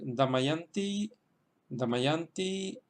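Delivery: slow "Damayanti", accented on YAN; slow teacher's demonstration of "Damayanti", YAN stressed. Shown as a demonstration. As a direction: model "Damayanti" slowly, holding the third syllable YAN. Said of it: "el acento se queda en la sílaba yan".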